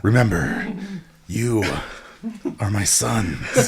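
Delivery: slow, halting mentor with long pauses; Jack Palance. Pretend. Co-host playing Splinter addressing the turtles, slow mock-solemn voice. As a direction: space out each phrase with mock gravity. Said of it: "Jack Palance was the voice of Splinter".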